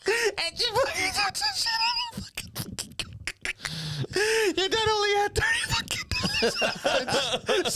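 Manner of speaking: high, wheezy laughing mom; breathless laughing. Retold or imitated. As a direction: gasp it out, out of breath. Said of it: "like she's out of breath".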